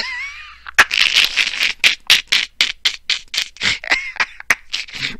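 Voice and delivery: long, loud, breathless laughing fit; helpless laughter. Real, not acted. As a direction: lose it completely laughing. Cracking up at the meme.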